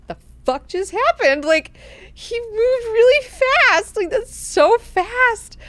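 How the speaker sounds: very high, loud, drawn-out disbelief; freaked-out amazement. Retold or imitated. Duncan face down after being tripped, freaked-out amazement. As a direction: squeal it slowly, stunned by the speed. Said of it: "he also is freaked out".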